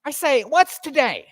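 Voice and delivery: high, eager call from the window; giddy old man shouting down to the street; excited old Scrooge. A narrator's reading. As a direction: call down to the boy, thrilled. Scrooge calls down to boy.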